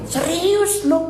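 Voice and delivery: high put-on Jakarta drawl; mock Jakarta slang. Pastor mocking a Manado actor's stiff Jakarta slang, high and hammed-up. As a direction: ham up the city slang. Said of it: "Dia bagi".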